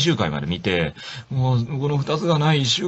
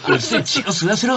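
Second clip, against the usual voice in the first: high mock vampire demand; mock vampire snarl; 11 semitones higher than usual. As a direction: ham up a bloodthirsty vampire, lightly. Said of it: "って言って".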